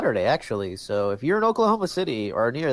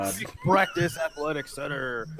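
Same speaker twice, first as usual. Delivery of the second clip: loud hyped-up announcer voice; monster truck ad; 4 semitones higher, 3 dB louder than usual. Pretend. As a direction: boom it like an arena promo. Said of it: "like a monster truck commercial".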